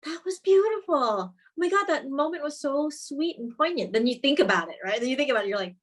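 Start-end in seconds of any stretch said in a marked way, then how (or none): 0.1-3.9 high-pitched, gushing mock audience reaction. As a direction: gush like a charmed viewer. Acts out a moved viewer's gushing.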